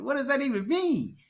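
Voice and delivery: high, loud confused outburst; baffled. Retold or imitated. Confused trainee asking his mentor what he means. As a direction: throw it back, confused and high. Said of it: "I'm like".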